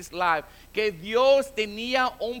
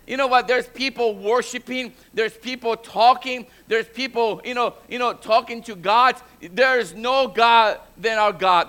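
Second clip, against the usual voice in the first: louder, insistent sermon delivery; forceful preaching; 5 dB louder than usual. Real, not acted. Preacher declaring no God greater than ours, forceful preaching. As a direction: drive it home to the congregation.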